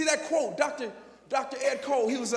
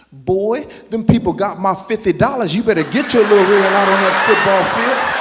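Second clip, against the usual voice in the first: loud scolding mother; stern mom; 3 semitones lower, 11 dB louder than usual. Retold or imitated. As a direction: scold the boy firmly. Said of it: "she said".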